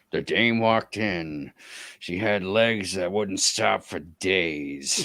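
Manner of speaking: slow, hardboiled private eye narration; drawn-out film noir voiceover; noir detective. Pretend. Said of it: "sounds like the main character of a hard-boiled noir".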